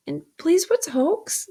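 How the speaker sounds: put-on high, rising boy's question; polite child. A narrator's reading. Pip asks what hulks are, polite child.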